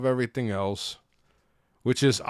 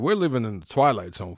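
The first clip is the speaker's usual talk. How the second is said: ordinary talk, nothing marked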